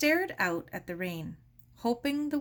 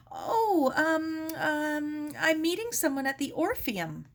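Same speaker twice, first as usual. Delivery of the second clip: put-on higher, hesitant young girl caught out; flustered girl; 9 semitones higher, 3 dB louder than usual. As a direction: stammer out a cover story. Sarah caught off guard, hesitant.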